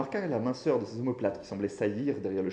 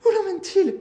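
very high, loud cry; screaming; 11 dB louder than usual. A narrator's reading. Children's mother as her husband is taken, screaming. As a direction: scream the question in panic. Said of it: "hurla la mère des enfants".

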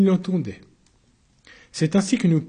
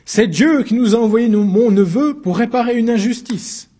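high, loud fervent claim; loud proclamation; 6 semitones higher, 5 dB louder than usual. Acted. Uncle proclaiming divine justice, loud and high. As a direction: proclaim it to the whole court.

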